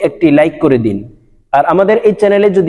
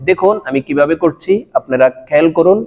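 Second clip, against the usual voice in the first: ordinary talk, nothing marked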